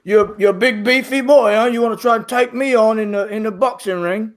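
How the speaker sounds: a hint of a swaggering half-orc fighter; tough half-orc. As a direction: sound like a fighter spoiling for a brawl. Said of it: "He says".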